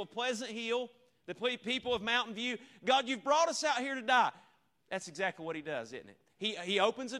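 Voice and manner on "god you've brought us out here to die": loud, high griping accusation at God; hammed-up grumbling, thrown up to the heavens; mock-indignant wail of a complainer; whiny complaint. Congregation complaining to God, mocked.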